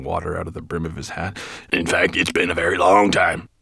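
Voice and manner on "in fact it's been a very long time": deferential dwarf voice; dwarf servant. A narrator's reading. Dwarf reminding his master of the long wait, deferential. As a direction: press the point to your master.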